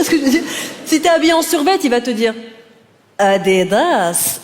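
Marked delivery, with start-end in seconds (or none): 3.2-4.4 very slow, low drawn Adidas; slow street harasser murmur. Retold or imitated. Street harasser murmuring to a girl in a tracksuit, slow. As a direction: drag the brand name out like a murmured come-on. Harasser whispering brand name.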